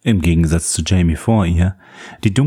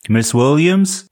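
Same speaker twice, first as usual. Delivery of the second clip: calling; 7 semitones higher, slower than usual. Acted. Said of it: "ertönte erneut die Stimme".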